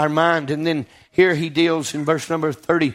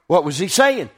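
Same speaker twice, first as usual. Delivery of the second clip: ordinary talk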